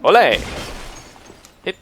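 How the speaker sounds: loud, high bullfighter cry; playful olé. Pretend. Player dodging an enemy's attack, playful olé shout.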